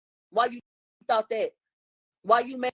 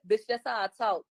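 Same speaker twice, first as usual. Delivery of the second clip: ordinary talk, nothing marked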